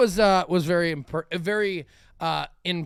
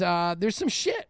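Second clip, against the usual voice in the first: ordinary talk, nothing marked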